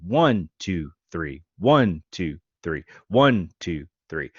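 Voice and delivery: "One" punched, "two, three" lighter, like a waltz; waltz count, stress on one. Shown as a demonstration. Teacher counting a waltz for students, leaning on "one". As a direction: count the beats, leaning on "One" each bar. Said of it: "we have three beats per measure".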